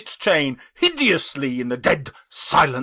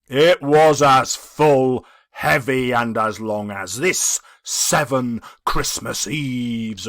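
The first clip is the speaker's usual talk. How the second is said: slow, heavy spectral voice; solemn ghost; 4 dB louder than usual. Acted. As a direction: weigh each word like a chain. The ghost speaks.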